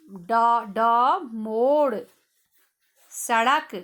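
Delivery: ड, मोड़ and सड़क said clearly with firm retroflex sounds; sound demo. Shown as a demonstration. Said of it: "देखो, एक ड का ड़ प्रयोग कैसे हुआ है".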